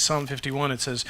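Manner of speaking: usual speaking voice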